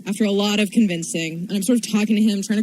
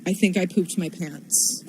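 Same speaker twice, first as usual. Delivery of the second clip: grave and serious; 5 semitones lower, 5 dB quieter than usual. Retold or imitated. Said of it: "dead serious and just gravely says".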